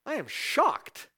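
God faking horror at honest anger; mock shock. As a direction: pretend to be scandalised, tongue in cheek. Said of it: "He doesn't go".

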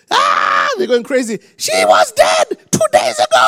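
high, loud amazed cry; excited shouting. Retold or imitated. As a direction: shout it in astonishment.

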